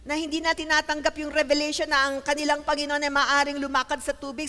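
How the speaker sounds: ordinary talk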